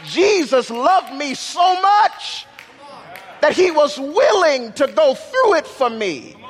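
high, shouted joy; excited. Real, not acted. Preacher to congregation, carried away with excitement. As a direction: let the excitement burst out. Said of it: "I'm getting a little bit too excited".

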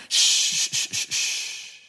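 soft breathy shushes, fading away; shushing. Acted. Spies hushing the excited crowd, soft unvoiced shushes. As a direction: hush the whole crowd down. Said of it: "They hush the congregation".

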